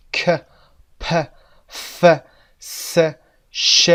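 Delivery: ک، پ، ف، س، ش each said alone without voice; isolated voiceless sounds. Shown as a demonstration. Teacher models unvoiced consonants one by one. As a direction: breathe out each sound with no hum. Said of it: "آن وایس ها مثل صداهای".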